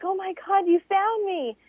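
high, relieved cry. Retold or imitated. Stranded racer greeting her rescuers, high and relieved. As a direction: gasp it out in relief.

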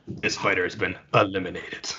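a hint of deadpan game announcer. Announcer declaring a dumped fighter out, lightly voiced official announcement. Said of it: "dump you in the water and go".

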